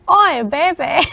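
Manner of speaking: mock British Cockney "Oi, baby"; a jokey put-on British accent. Friend imitating Mama Bear in a British accent. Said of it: "they do the British accent".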